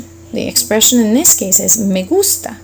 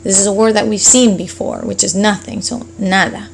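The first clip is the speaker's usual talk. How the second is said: usual speaking voice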